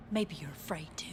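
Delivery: soft breathy whisper, barely voiced; stage agent whispers a dare at the doctor; whispered taunt.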